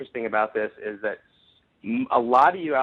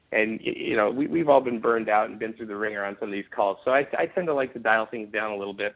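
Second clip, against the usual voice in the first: normal talking voice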